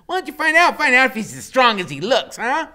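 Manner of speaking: high, loud, teasing; giggly voice. A narrator's reading. Loretta goading Iggy, giggly and high. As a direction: egg him on with giggles. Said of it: "strange, giggly voice".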